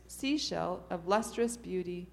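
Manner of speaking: plain reading voice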